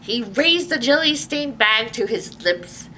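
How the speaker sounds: usual speaking voice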